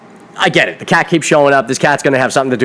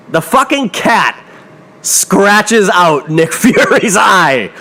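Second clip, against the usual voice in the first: exasperated; 5 semitones higher, much slower than usual. Real, not acted. Outraged rant about the cat.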